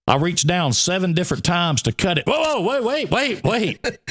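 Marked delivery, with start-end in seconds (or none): 2.3-4.0 high, urgent whoa wait; alarmed whoa. Retold or imitated. The pool man yelling at the teller to stop cutting, high and alarmed. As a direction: stop him mid-cut, alarmed.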